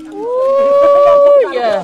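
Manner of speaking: very high, loud delighted cry; excited shriek. Real, not acted. Woman opens the last box and shrieks with delight. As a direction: squeal with joy at the surprise.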